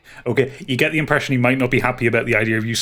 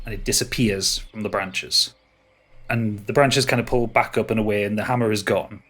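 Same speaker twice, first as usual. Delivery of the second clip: ordinary talk, nothing marked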